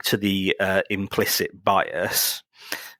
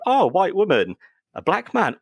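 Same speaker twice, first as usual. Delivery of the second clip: higher and louder, a put-on gasp of discovery; mock surprise; 11 semitones higher, 5 dB louder than usual. Mock reply listing Netflix picks.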